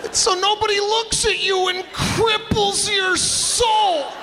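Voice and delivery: high, slow mock-ominous voice; high hammed-up spooky warning; spooky explanation. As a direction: warn of demons in an eerie hush. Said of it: "I'd have to explain".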